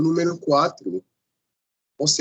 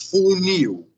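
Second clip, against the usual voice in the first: "Funil" with a high, strong -NIL that falls away; teacher's demo of "Funil", punching NIL; stress on last syllable; 5 semitones higher, 4 dB louder than usual. Shown as a demonstration. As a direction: say "Funil" as foo-NEEW, second syllable raised. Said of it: "a sílaba forte é a última sílaba".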